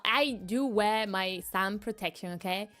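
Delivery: ordinary talk, nothing marked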